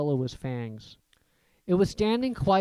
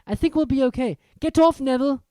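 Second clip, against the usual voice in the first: Harry; 9 semitones higher, 6 dB louder, faster than usual. A narrator's reading. Harry urgent.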